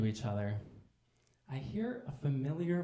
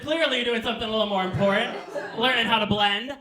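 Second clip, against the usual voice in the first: much higher, louder, mock apology; loud sassy interjection; 9 semitones higher, 10 dB louder than usual. Comic to a general caught watching makeup videos, loud sassy mock apology. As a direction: call it out high and loud, playfully sassy. Acted quip to the general lady.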